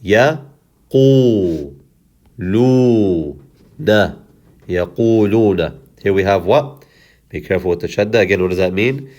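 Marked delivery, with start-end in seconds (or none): 0.0-4.2 Ya, Qu, Lu, Na apart with pauses; one isolated syllable at a time: Ya, Qu, Lu, Na. Shown as a demonstration. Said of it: "we'll do it two in one". 4.7-5.8 Yaquluna in one flow with long u vowels; connected, drawn-out Yaquluna; whole word joined. Shown as a demonstration. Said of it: "we'll do it two in one".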